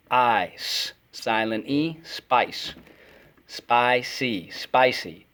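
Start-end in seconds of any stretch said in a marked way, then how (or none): none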